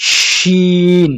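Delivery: a long, stretched "শিন" with full-mouth air; teacher's slow demo of "শিন"; drawn-out shin. Shown as a demonstration. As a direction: hold the "শিন" hiss long and open. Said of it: "সেটা হবে সিন".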